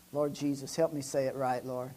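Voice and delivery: hushed mock prayer. Preacher asks the Lord for help telling it, hushed plea. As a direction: drop into a quiet little prayer.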